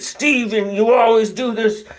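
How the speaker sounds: a hint of an exasperated wife; wife yelling at Stephen. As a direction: fling it at him like a fed-up wife. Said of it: "ripped it off the wall and said".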